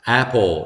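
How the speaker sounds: 'apple' with mouth wide open on the a; wide-open vowel. Shown as a demonstration. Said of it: "입을 쫙 벌리고 하는 발음". ('apple'의 a를 입을 쫙 벌려서)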